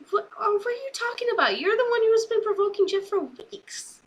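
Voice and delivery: high, fast indignant squeak; mock indignation. Arguing at the TV about Samoa Joe, fast high mock outrage. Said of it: "And it's like".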